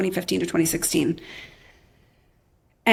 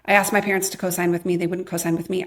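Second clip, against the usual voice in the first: usual speaking voice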